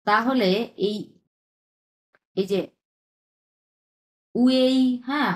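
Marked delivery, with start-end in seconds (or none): 4.3-4.8 "উয়েই" higher, gliding from u into ei; spoken pronunciation. Shown as a demonstration. Teacher shows how it must be pronounced, clear demo. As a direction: model "উয়েই" clearly as one gliding syllable. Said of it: "উচ্চারণ করতে হবে আপনার এটা".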